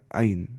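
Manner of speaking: "عین" with a plain vowel onset, no throat sound; mispronounced ain. Retold or imitated. Teacher mimics a student reversing the letters, throatless ain. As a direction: imitate the mistake: "عین" said like an alif. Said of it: "الٹا کر رہے ہو۔".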